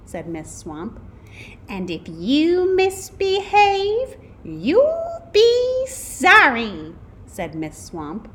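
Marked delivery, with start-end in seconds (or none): 1.7-6.9 high, loud, drawn-out threat; threatening mean teacher. A narrator's reading. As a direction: menace the class slowly and loudly. Mean substitute threatens the class.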